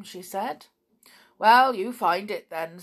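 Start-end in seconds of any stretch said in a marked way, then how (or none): 1.4-2.8 curt and loud; gruff retort. A narrator's reading. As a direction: snap it back. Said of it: "said Mister Gomp".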